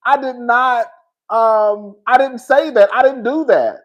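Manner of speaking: mock flustered denial, high; high insistent denials as Riley; imitating Riley protesting she didn't do it; Riley denying it. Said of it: "Riley was like".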